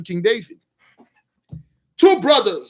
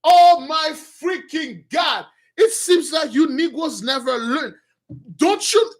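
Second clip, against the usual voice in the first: outraged shout; 6 semitones higher, slower than usual. Retold or imitated. King David furious at the two brothers, slow outraged shout. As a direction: roar it out in outrage. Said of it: "David stayed up like".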